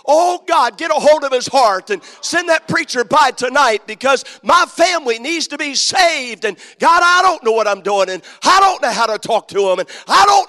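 loud, high, pleading cry to God; a father crying out to God to send the preacher; desperate shouted prayer. Retold or imitated. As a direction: beg heaven at full voice. Retold desperate prayer in the woods.

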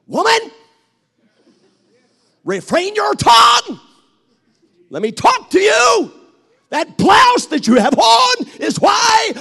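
husband shouting back. Acted. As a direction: bellow at her to hold her tongue. Husband shouts back at his wife.

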